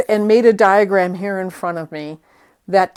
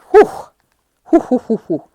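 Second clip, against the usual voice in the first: short puffs of relieved breath; relieved whew; 5 semitones higher, 6 dB louder, faster than usual. Real, not acted. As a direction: blow out air in quick relieved puffs. Relief after tricky tape step.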